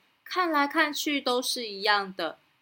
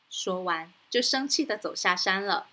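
plain reading voice

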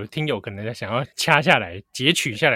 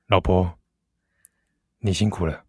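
low, close bedtime murmur; intimate murmur; 8 semitones lower than usual. Acted. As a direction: murmur it close to her ear. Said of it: "很close的".

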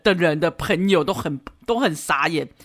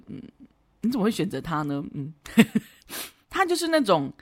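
ordinary talk

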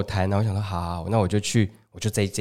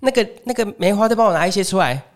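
higher auntie voice calling back to the stall; auntie calling to helper; 8 semitones higher than usual. Retold or imitated. Auntie calling to her helper at the back, in a higher voice. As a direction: call out an order to the back. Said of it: "对那个后面的小姐姐说".

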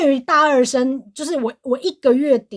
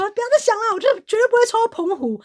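self-reassurance; 12 semitones higher than usual. Retold or imitated. Talking herself out of panic.

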